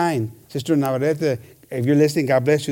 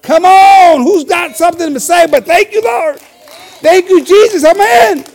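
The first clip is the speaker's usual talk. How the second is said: fired-up preaching; 13 semitones higher, 11 dB louder, slower than usual. Real, not acted. Preacher stirs the congregation.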